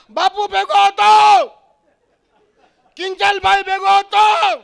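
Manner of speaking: high, drawn-out mock shout; hammed-up defensive yell; husband yelling back. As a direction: holler it back, stretched out. Husband shouts back his alibi.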